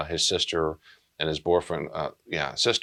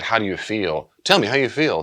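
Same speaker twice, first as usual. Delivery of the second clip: a friend pressing a numb survivor, louder and insistent; pushy demand; 4 semitones higher, 6 dB louder than usual. Retold or imitated. A friend pestering someone in shock, insistent and loud. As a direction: push the question on them, louder and higher.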